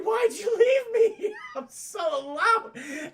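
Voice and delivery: high, loud, slow fake wail; mock sobbing droid. Broken droid wailing at Luke, high fake sobbing. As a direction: wail it like a whiny robot.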